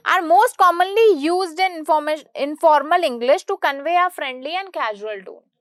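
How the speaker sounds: slow, loud, measured reading of a definition. Shown as a demonstration. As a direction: read the textbook line out slowly and clearly. Reads the definition aloud, slowly.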